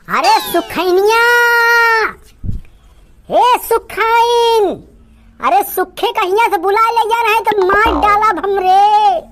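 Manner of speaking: high put-on wife voice, shouting for the neighbour, stretched slow; wife calling neighbour Sukhaini to beat her husband. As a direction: call across the village in a high woman's voice.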